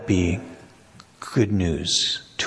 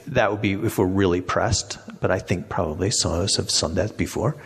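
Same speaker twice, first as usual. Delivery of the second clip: ordinary talk, nothing marked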